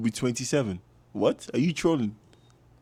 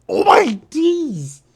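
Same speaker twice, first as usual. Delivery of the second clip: high, slow, crying-out version; joyful cry; 14 semitones higher, slower than usual. Real, not acted. Repeats with a cry.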